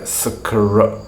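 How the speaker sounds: "skr" sounded out slowly, piece by piece; slow teacher's breakdown of "skr"; cluster broken down. Shown as a demonstration. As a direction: draw out "skr" as s, k, r. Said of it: "all sticking together".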